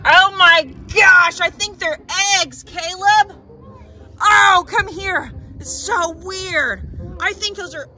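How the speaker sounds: high, slow excited shouting. Real, not acted. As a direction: shriek at the find. Finds eggs in hole.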